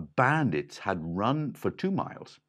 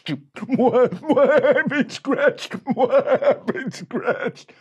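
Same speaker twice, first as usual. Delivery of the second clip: high loud slow laughing cry; laughing wail; 11 dB louder, much slower than usual. A narrator's reading. Scratched bandit wailing through laughter. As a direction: wail while cracking up.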